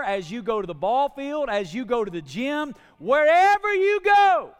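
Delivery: loud rhythmic list building to a drawn-out shout; full-force preaching. Real, not acted. Preacher urging the church wherever they go, building to a shout. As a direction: hammer each phrase, then explode high and long on the last.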